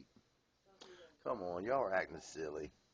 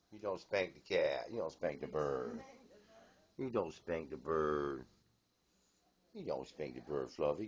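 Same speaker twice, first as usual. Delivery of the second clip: slow, firm, lower scold to the bird; stern scolding; 6 semitones lower, slower than usual. Real, not acted. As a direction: lay down the rule slowly to a naughty pet.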